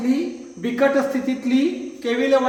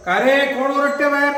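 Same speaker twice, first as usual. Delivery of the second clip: raised, higher shout from the balcony; calling out; 5 semitones higher, 7 dB louder than usual. Acted. Rich master shouts down from balcony to the street, raised call. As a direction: demand to know who is shouting outside.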